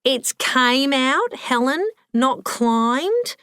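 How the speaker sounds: slow, high, pointed correction; correcting. Acted. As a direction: spell out "came out" against "climbed" deliberately. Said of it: "the fat lady corrected her".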